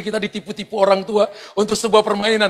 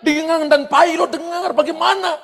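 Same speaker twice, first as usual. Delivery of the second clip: high-pitched incredulous challenge; mock the idea as impossible; retold schoolmates' disbelief; scoffing friends; 7 semitones higher than usual. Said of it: "teman-teman katakan ini".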